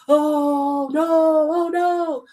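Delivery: drawn-out, wailed oh no; mock helpless dismay. Imitating people who sit back and hang back, mock dismay. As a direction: moan it long and helplessly, in mockery. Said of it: "hanging back and saying".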